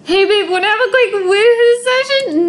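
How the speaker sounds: high, laughing flirty ghost voice; ghost husband flirting. Acted. Gamer voices ghost Tanner flirting with Daisy, high and laughing. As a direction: tease it like a cheeky dead husband, through a laugh.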